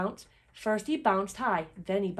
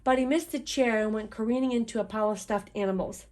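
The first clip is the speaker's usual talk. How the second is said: ordinary delivery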